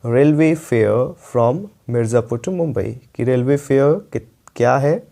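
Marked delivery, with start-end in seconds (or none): 0.0-3.0 slowed-down repeat of the sentence; deliberate teacher repeat. Shown as a demonstration. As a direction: say it again slowly so students catch it. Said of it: "देखिए फिर से मैं बोल रहा हूँ".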